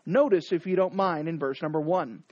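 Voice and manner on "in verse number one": usual speaking voice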